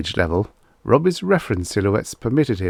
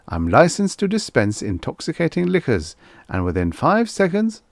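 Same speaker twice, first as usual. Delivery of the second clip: robot salesman pitch; 5 semitones higher than usual. Acted. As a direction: sell it like a showman robot.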